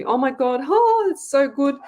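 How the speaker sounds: imitating giddy excitement; excited spirits. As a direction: gush like spirits who can't wait. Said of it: "She goes".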